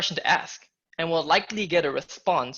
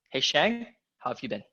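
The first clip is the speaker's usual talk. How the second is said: "Hey, Shang" rising, then "how have you been" much lower and softer; downward inflection; 4 semitones lower, 4 dB quieter than usual. Shown as a demonstration. Coach demonstrating how the inflection goes down, mysterious. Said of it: "the inflection goes down".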